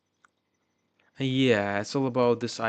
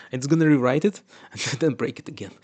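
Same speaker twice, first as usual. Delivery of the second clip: laughing; 3 semitones higher, 9 dB louder, faster than usual. Real, not acted.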